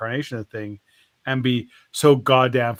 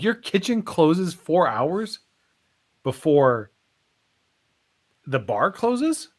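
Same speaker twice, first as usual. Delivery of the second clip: drawn-out, higher incredulous question with pauses; slow disbelief; 3 semitones higher, slower than usual. Acted. Shark querying the bartender's closing hours, slowly incredulous. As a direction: spell out the absurdity piece by piece.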